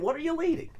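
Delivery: high-pitched mock disbelief; incredulous retort. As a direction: throw the claim back as a baffled question. Challenging the chairman's quoted claim.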